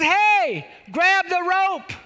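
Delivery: high, loud acted holler; drawn-out rescuer's shout. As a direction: yell down from a helicopter. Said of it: "Charlie shouts down and says".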